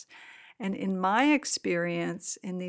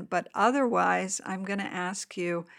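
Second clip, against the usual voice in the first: guided meditation. Acted. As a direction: gently guide them into the body.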